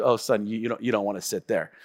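low, soft knowing dad; dad's voice. Retold or imitated. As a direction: drop low and soft like a wise father. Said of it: "He said".

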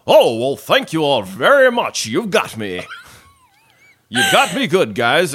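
loud, hammed-up 1940s radio actor; old-time radio voice. Birthday man thanks his surprise party guests, old-time radio voice. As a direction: project it high and theatrical, like old radio. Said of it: "sounds like he's from a nineteen forties radio program".

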